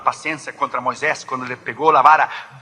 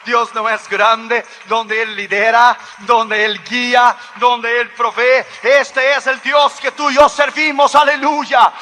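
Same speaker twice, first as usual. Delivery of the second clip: high, loud, exultant proclamation; full-force preaching; 10 semitones higher, 6 dB louder than usual. Real, not acted. Preacher urging congregation to praise God, full-force shouting. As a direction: build to a shouted call to praise.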